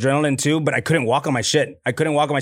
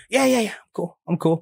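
playing it cool; 4 semitones higher than usual. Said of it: "And I'm like".

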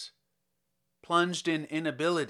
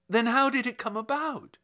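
eager question; 9 semitones higher, 5 dB louder than usual. A narrator's reading. Kovalev eager for news.

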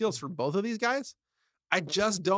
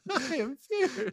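put-on high, drawn-out baby voice with laughter; mock newborn voice; 6 semitones higher, slower than usual. Joking about a baby born naming himself, slow high baby voice.

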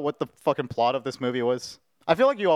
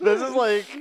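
high laugh, voice cracking; 10 semitones higher than usual. Real, not acted. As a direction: laugh till it squeaks. Cracking up at the face.

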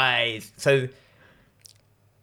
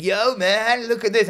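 stoner voice; 8 semitones higher than usual. Retold or imitated. Mimicking the stoner coworker.

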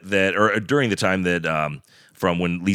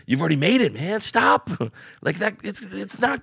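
mock exasperated plea; 12 semitones higher than usual. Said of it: "I'm like".

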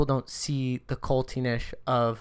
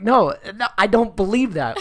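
louder, higher, exasperated refusal; emphatic refusal; 6 semitones higher, 6 dB louder than usual. Acted. Doubter answering a Harry Potter believer, loudly exasperated. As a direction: push back hard, voice raised.

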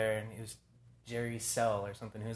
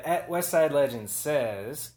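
drawn-out announcement; 3 semitones higher, 9 dB louder, much slower than usual. Real, not acted. Announcing a listener's question.

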